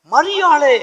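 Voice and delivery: high, loud call to Mary; preacher acting a loud summons. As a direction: call her name out, ringing and drawn out. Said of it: "என்று அவர் கூப்பிட்ட மாத்திரத்தில்".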